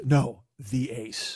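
slow, low correction with a deliberate "the"; stress on THE. Retold or imitated. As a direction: spell it out slowly, weighting "the" before "ace". Passenger corrects him, insisting on article.

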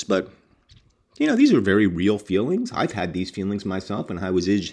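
plain reading voice

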